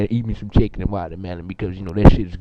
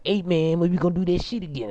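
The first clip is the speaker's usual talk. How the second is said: higher-pitched mock voice of guys asking; imitating other guys; 8 semitones higher than usual. Guys in the hallway asking the speaker, imitated in a higher voice. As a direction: put on an eager caller's higher voice. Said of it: "they was like".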